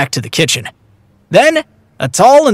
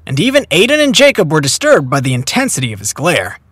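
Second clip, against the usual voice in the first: usual speaking voice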